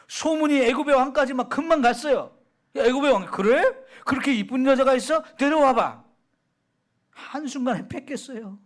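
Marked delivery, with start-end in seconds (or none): 2.8-6.0 lightly voiced eager king, a bit louder; a hint of a greedy king calling for the woman; king summoning. Preacher voices the Egyptian king.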